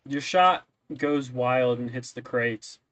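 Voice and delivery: ordinary delivery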